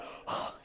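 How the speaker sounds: breath-only demonstrated huff; exasperated sigh. Shown as a demonstration. Preacher coaxing congregation to join in, exasperated sigh. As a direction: let the annoyance out in one sigh. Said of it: "You need to get out".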